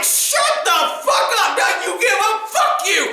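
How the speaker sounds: high, heated retold yell; worked-up, high-pitched outburst; angry retort. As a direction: re-enact a furious cop cursing a suspect. Officer angrily yelling at suspect.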